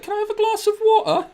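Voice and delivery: high, piping English boy impression; imitating a British child. Teasing cousin's son by mimicking him, squeaky British child voice. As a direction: put on a tiny British kid's voice. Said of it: "that just annoyed the living daylights out".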